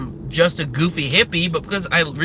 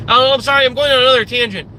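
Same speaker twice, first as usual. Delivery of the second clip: higher, louder, hurried self-interruption; sudden mock apology; 6 semitones higher, 4 dB louder, faster than usual. Real, not acted. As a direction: cut yourself off, apologising high and fast. Interrupts own rant to apologise.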